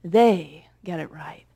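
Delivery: slow breathy whisper, hushed and intimate. Acted. Preacher to congregation about writers, a hushed whisper. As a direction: let the phrase drop to a secret whisper.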